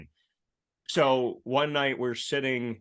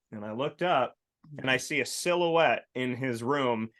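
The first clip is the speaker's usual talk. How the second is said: normal talking voice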